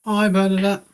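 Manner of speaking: high-pitched, put-on welcoming greeting; warm welcome. Real, not acted. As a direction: greet them with an exaggerated high lilt. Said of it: "Why are you talking like that?".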